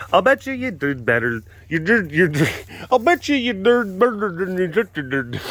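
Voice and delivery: high, exaggerated tongue-tied repeat; garbled mock repeat. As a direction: garble it playfully, pitch climbing. Garbles own line, then laughs.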